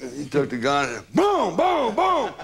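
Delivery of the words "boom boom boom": shouted boom boom boom, much higher; excited shouted gunfire sounds; gunshot imitation. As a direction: blast out the gunshots like a cartoon. Imitating gunshots while laughing.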